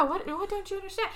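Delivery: high-pitched exasperated demand; mock exasperation. Viewer scolding the characters, mock exasperation.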